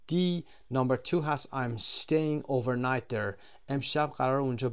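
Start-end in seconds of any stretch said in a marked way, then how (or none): 1.5-3.3 slow, careful example sentence; slow model reading. Shown as a demonstration. Teacher reading the example to students, slow and careful. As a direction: read it out slowly for the class. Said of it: "teacher models example slowly".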